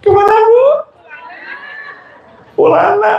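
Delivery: very high mock squeal; playful high-pitched jab at the ladies; squeaky teasing. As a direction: tease the women in a squeaking voice. Teasing high call to crowd.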